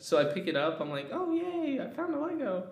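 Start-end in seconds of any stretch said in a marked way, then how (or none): 1.1-2.6 put-on very high, bubbly child voice; excited kid. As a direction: squeal it out like a thrilled kid.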